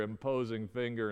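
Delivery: usual speaking voice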